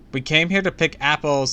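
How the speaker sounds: high, loud exasperated call; shouting. A narrator's reading. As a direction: shout it out to unruly kids. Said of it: "Sabrina shouted".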